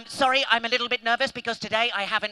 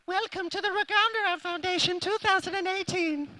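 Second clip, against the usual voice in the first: squeaky, high-pitched comic welcome; silly high voice; 8 semitones higher than usual. Pretend. Magician welcomes the audience after his orange flavour, silly high voice. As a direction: pipe it up high all the way through.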